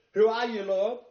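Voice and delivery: Paul thrown from his horse, gasping in shock; gasped cry. Retold or imitated. Paul struck by the vision asks the Lord, gasping loudly. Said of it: "he gasped".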